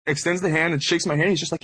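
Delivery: normal talking voice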